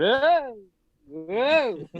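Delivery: high, loud swooping "oh, whoa"; mock wailing whoa. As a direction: imitate yells swooping past a microphone. Imitates yells heard through passed mic.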